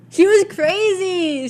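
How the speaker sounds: high, slow exasperated cry. Real, not acted. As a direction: drag it out in disbelief. Exasperated about instructor.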